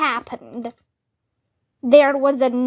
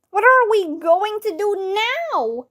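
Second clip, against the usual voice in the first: a higher, panicky cry; scared kid shouting the question; panicked shout; 7 semitones higher than usual. A narrator's reading. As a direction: cry out not knowing what to do. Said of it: "shouted Neil".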